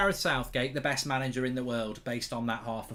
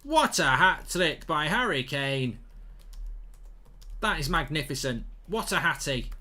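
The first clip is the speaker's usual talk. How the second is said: slow, raised play-by-play call of the goals; mock commentary; 6 semitones higher, 6 dB louder, slower than usual. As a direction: narrate the replay like a TV commentator.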